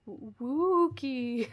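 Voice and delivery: playfully haunted, very slow; spooky mock howl. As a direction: draw out the oo like a ghost. Spooky W-word offered in jest.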